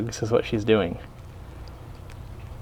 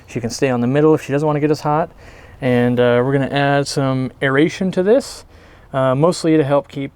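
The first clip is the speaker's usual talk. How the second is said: normal talking voice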